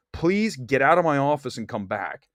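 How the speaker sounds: ordinary talk, nothing marked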